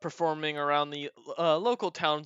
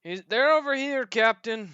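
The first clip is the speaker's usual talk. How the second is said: put-on slurred, high drunk dwarf; drunken voice; 6 semitones higher, 4 dB louder than usual. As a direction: call out like a tipsy dwarf. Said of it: "a very drunken voice go".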